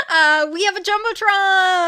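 high, loud announcer shout; excited announcement. Pretend. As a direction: announce it like a stadium host. Announcing the Jumbotron gag.

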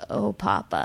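put-on a boy's hushed lament, "Oh, Papa."; mild imitation of a sad child; sad little boy. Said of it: "during these really sad moments".

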